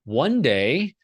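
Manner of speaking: normal talking voice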